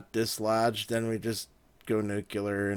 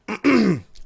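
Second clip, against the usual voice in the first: throat clearing; 12 semitones higher, 10 dB louder than usual. Real, not acted. About to make an awkward confession.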